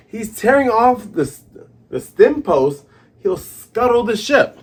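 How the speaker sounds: high, loud cry of warning; acted alarm. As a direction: raise the alarm as panicked crew. Acting out alarmed pirates.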